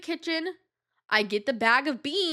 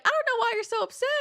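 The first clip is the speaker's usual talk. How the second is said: high, quick put-on mom voice; a hint of a laughing mother, high and quick; mom's amused voice; 13 semitones higher, faster than usual. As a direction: toss it off lightly like an amused mom. Mom reassuring on the phone.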